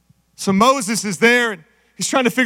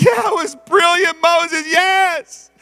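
excited cheering; 6 semitones higher than usual. Retold or imitated. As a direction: celebrate like a crowd that has won.

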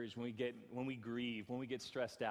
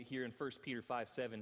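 usual speaking voice

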